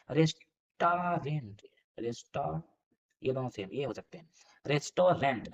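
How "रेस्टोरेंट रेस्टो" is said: slow sounding out. Shown as a demonstration. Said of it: "restaurant नहीं होता है".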